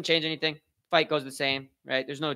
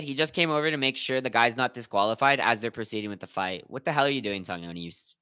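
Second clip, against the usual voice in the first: usual speaking voice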